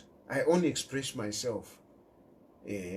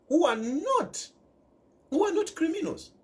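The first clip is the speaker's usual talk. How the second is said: rising indignation; 13 semitones higher than usual. Real, not acted. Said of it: "rising indignation".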